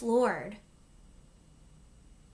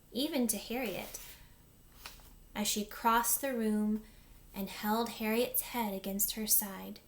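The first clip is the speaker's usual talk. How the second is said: ordinary delivery, nothing marked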